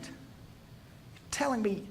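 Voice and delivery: slow, hushed whisper of disbelief. Acted. Angel repeating You're telling me to Zach, whispered slow.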